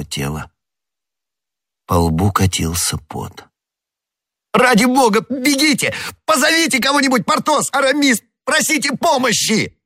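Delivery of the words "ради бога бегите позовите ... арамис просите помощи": very high-pitched, panicked orders; frantic shouting. A narrator's reading. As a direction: yell for someone to fetch help. Frantic calls for help. (панический крик о помощи)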